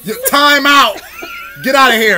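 loud mock outburst, half laughing; shouting him down for the joke; retold shout. Coworker telling off a bad joke, loud mock shout.

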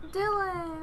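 excited call. Real, not acted. Excited at cat's name.

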